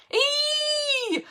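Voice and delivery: high, held Fonz-style Ee. Retold or imitated. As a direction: squeal it out like the Fonz. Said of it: "I sounded like the Fonz".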